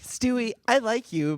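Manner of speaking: high, hesitant letdown; mock talent judge. Replaying talent judge scene.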